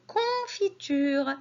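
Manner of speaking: "confiture" slowly, with a long "con"; teacher sounding out "confiture" for children; syllable by syllable. Shown as a demonstration. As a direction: stretch the first syllable of "confiture". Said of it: "la syllabe que j'entends".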